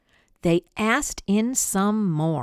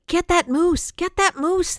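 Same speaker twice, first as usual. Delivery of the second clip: higher, louder hunter's call; a hunter calling to shoot the moose; 10 semitones higher, 5 dB louder than usual. Acted. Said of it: "heard a voice call".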